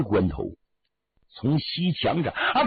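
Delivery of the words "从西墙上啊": usual speaking voice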